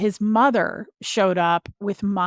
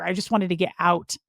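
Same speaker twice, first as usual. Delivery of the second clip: usual speaking voice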